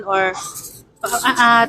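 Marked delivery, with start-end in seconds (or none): none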